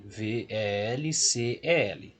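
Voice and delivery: slow, letter by letter; spelled slowly. Shown as a demonstration. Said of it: "vogal e consoante". (soletrando devagar)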